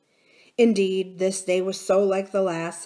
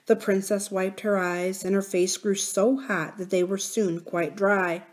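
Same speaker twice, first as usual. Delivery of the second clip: ordinary delivery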